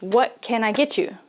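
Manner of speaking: What can I get you rattled off quickly, all linked; quick native rhythm, no gaps between words; fast and flowing. Shown as a demonstration. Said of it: "that rhythm that you really should learn".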